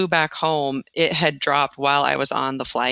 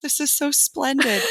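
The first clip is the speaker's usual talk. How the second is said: higher, prim and proper compliment, laughing through it; posh put-on praise; 10 semitones higher than usual. Teacher hugging the first lady, mock-proper praise with a laugh. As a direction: play the gracious guest with a laugh. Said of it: "I never say splendid".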